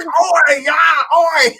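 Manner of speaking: high, loud mock Aussie "oi"; shouting oi while cracking a Foster's. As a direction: bellow it like a beer-drinking Aussie. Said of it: "I used to be like".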